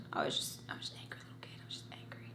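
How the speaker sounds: breathless, fading confession; real whisper. Real, not acted. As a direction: whisper it almost to nothing. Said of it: "whispered admission".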